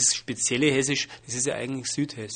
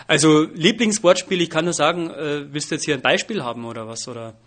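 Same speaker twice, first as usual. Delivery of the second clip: mock exasperation; 2 semitones higher, 8 dB louder than usual. Comedian playfully protesting the interviewer's question, loud and mock-indignant. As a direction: throw it back at the interviewer in mock outrage.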